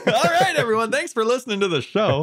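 high-pitched joke show outro; mock sign-off. Teasing co-host that the show is over, mock sign-off.

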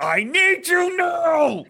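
loud, high, slow insistence; urgent demand. Retold or imitated. Dad insists his son come now, slow, loud and high. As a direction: stretch out the order firmly. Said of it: "I'm like".

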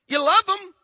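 loud and high on love; emphatic call. Real, not acted. Preacher urging the congregation to love each other, emphatic. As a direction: push the word love out.

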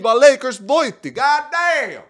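high, loud victory yell; excited shout. Real, not acted. Podcaster shouting about the Lakers win, excited yell. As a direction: crow the win at the top of the voice.

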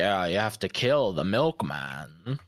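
slow, low Southern drawl; imitating a slow Southern cartoon guy; Southern accent. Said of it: "you sounded like the Southern guy from".

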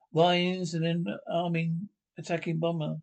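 usual speaking voice

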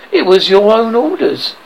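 plain reading voice

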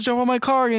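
high-pitched retold call; mock calling out. Vin Diesel urges jumper onto his car, mock shout. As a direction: imitate calling across to someone, high.

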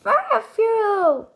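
high, drawn-out battle call; Amber imitation. Player copying a fire brawler's attack call, high imitation. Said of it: "Jetzt Amber".

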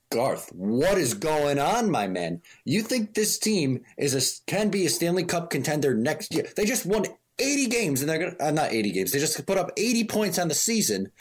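high-pitched, exasperated challenge; incredulous. Real, not acted. Host addressing the absent manager about his quote, incredulous.

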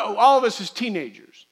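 ordinary talk

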